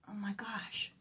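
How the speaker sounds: voiceless, very soft aside; barely audible, whispered dismay; exasperated whisper. Real, not acted. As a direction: whisper it to yourself in disbelief.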